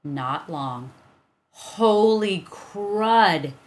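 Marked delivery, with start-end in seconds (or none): 1.5-3.5 drawn-out, loud dismay; shocked exclamation. A narrator's reading. Evan realizing he lost the money, shocked. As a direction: blurt it out in shock. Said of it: "he said suddenly".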